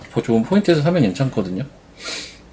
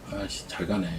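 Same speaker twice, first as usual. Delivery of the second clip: muttered curse, much softer; cursing under breath; 4 semitones lower, 15 dB quieter than usual. Real, not acted. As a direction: grumble a curse to yourself. Stock rising, regretting missed trade.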